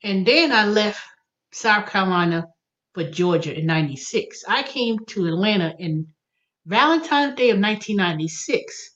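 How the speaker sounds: usual speaking voice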